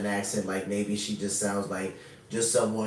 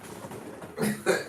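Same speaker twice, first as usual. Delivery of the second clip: breathy laugh; 3 dB quieter than usual. Real, not acted. Laughing at the clip.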